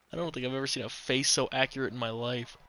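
normal talking voice

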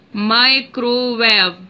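Microwave stretched as mi-cro-wave; slow syllable by syllable. Shown as a demonstration. Teacher to class counting syllables, stretched slowly. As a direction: give each beat of Microwave its own space. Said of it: "Three syllable word".

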